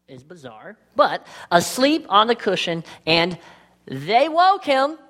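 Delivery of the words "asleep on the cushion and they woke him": slow, rising "they woke him" before a held silence; dramatic pause. Shown as a demonstration. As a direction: draw out "they woke him" and leave it hanging. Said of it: "Dramatic pause".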